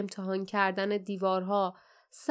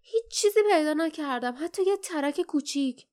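higher, frustrated outburst; annoyed; 6 semitones higher, 4 dB louder than usual. A narrator's reading. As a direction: let irritation push the pitch up. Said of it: "جاسمین با عصبانیت پایش را به زمین کوبید". (با عصبانیت و کلافگی)

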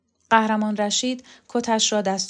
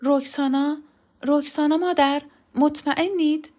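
higher, anxious questioning with a slight tremble; trembling voice; 7 semitones higher, 4 dB louder than usual. A narrator's reading. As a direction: ask in raised, worried disbelief.

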